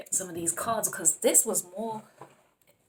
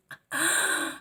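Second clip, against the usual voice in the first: delighted gasp; 11 semitones higher than usual. Real, not acted. Thrilled at the cards.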